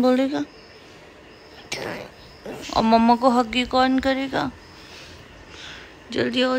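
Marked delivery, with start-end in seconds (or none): none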